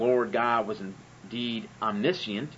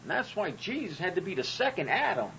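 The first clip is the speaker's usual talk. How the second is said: higher, put-on preacher voice, mocking; 8 semitones higher than usual. Mocking his old preacher's excuse, higher put-on voice. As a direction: ham up a preachy know-it-all. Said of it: "My preacher used to say".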